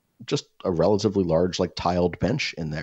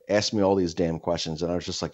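ordinary talk, nothing marked

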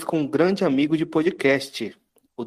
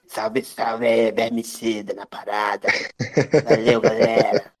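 lower-pitched podcast host voice; Vermicida impression; 4 semitones lower than usual. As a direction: mimic a buddy's radio greeting. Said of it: "imitando a voz de vocês". (imitação do Vermicida)

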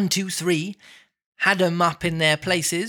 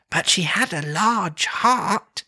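slow, higher ghostly voice; a gentle spirit, drawn out; 5 semitones higher, slower than usual. Acted. Ghost speaks in reading.

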